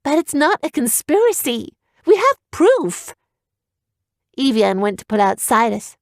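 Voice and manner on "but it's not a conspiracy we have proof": put-on high young heroine insisting; insistent girl. Evian insists.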